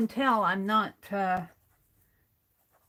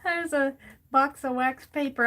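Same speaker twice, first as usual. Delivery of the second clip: laughing; 6 semitones higher, 5 dB louder than usual. Real, not acted. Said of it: "I'm laughing because".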